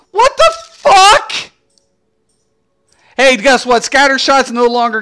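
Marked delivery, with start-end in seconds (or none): 0.1-1.3 high, loud, slowed incredulous cry; incredulous outburst. Real, not acted. Gamer yelling at the devs in disbelief. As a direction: let the outrage burst out high.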